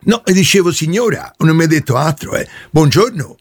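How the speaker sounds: plumber character voice. Acted. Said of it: "io sono Caio, il trombaio".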